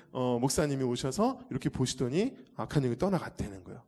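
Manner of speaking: normal talking voice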